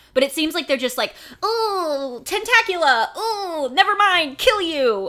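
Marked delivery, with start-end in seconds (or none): 1.4-5.1 ditzy mock caster. Mocking random spellcasters.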